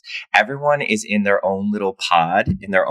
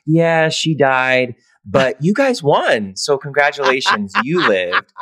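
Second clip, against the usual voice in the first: mock staff voice; 6 semitones higher, slower than usual. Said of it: "They were like".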